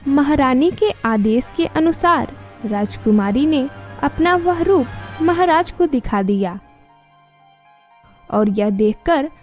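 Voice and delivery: plain reading voice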